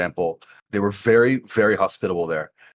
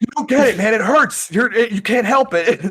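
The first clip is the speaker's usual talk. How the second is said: whiny protest; 13 semitones higher, 6 dB louder than usual. Retold or imitated. Protesting to laughing friends.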